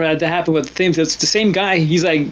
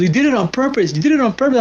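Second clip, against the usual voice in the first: mock angry accusation; 6 semitones higher than usual. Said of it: "he's got mad at me".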